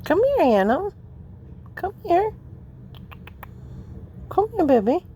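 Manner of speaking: high coaxing call to the cat; calling a pet. Real, not acted. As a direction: lure the cat over sweetly.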